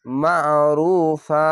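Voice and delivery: মা'রুফা very slow, the final vowel held one alif before stopping; drawn-out ending. Shown as a demonstration. Quran teacher showing how to stretch and stop at a verse end. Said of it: "এক আলিফ টেনে থেমে যেতে হবে".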